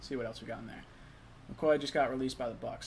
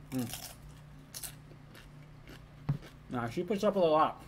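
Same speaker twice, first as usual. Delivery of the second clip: mouth full; 3 dB quieter than usual. Real, not acted. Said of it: "I shouldn't talk with my mouth full".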